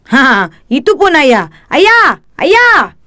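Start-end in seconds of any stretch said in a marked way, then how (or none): 1.7-2.9 calling out. Acted. Child calling father across crowd.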